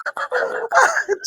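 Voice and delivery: silent, airless wheeze of laughter; breathless silent laugh; wheezing laugh. Real, not acted.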